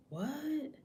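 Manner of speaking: soft, high-pitched questioning gasp of a word; stunned disbelief. Acted. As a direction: let it rise high but stay quiet. Woman's stunned inner thought.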